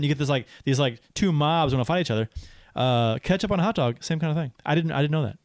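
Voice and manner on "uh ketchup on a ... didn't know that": usual speaking voice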